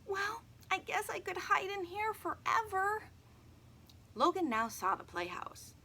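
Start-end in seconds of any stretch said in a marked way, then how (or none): 0.0-3.0 put-on a high little girl, sulking; a young girl, gloomy and high; girl's voice. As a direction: pitch up as the sad girl. Emily voiced by narrator.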